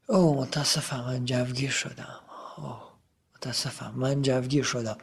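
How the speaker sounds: very soft, low apology; sheepish apology. Acted. Fan apologizes to a friend for overexcitement, sheepish and hushed. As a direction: apologize under your breath. Fan's apology, voiced in Persian.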